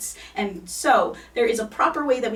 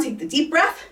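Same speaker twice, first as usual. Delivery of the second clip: ordinary talk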